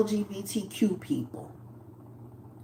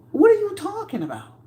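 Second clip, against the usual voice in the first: much higher, drawn-up disbelief; exasperated, high-pitched question; very high, stunned; incredulous; 11 semitones higher than usual. Real, not acted.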